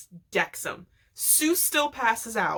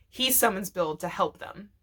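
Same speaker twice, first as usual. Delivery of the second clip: normal talking voice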